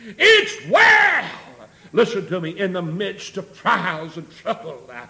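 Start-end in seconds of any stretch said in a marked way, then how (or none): 0.1-1.3 high, loud, ringing cry; preaching shout. Retold or imitated. Preacher repeating the woman's answer to church, shouted. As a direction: proclaim it at the top of the voice.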